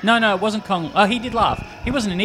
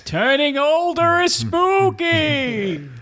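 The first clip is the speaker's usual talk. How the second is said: spooky voice; 12 semitones higher, much slower than usual. Pretend. Mock spooky line.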